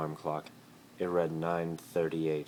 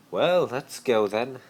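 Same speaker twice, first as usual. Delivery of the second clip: higher, loud, slow, sing-song; brisk Malfoy; 8 semitones higher, 10 dB louder, slower than usual. Acted. Malfoy hurrying Harry out, loud and theatrical. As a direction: sweep him off with a flourish. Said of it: "He grabbed".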